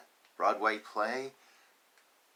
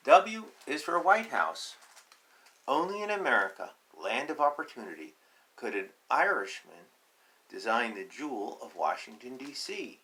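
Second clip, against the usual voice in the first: reading a picture book aloud; 4 dB louder than usual. Acted. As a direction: proclaim it grandly to the class.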